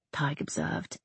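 ordinary delivery, nothing marked

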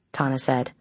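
ordinary delivery

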